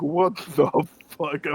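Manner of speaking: drawn-out, stunned disbelief; mock-stunned retelling; shocked reaction. Acting out her shocked reaction.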